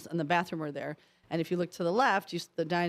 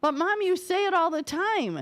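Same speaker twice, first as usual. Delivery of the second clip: put-on protesting child; 9 semitones higher than usual. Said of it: "I'm like five going".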